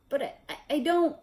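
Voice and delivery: high, fast, panicked stutter; Quirrell stammering. A narrator's reading. Quirrell denying to Snape, panicked stammer. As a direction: gabble the denial in fright.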